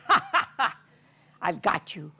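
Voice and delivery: loud laughing lion; lion catches the mouse. Acted. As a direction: gloat with a laugh.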